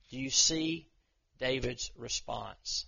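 ordinary delivery

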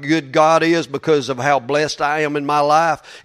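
usual speaking voice

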